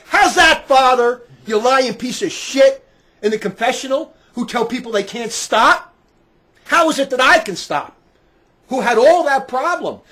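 furious, accusing shout; angry challenge. Acted. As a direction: confront him at full volume, outraged.

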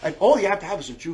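ordinary talk, nothing marked

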